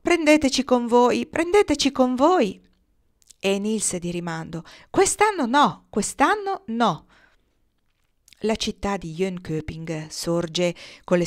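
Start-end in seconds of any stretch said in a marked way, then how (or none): 0.0-2.6 high, loud plea, rising on the repeat; raised-voice entreaty; imploring. A narrator's reading. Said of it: "e implorarono". 4.9-7.1 high, loud refusal, slowing on the repeat; raised, high-pitched reply; called-back refusal. A narrator's reading. Nils shouts his refusal back.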